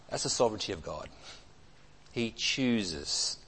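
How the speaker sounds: usual speaking voice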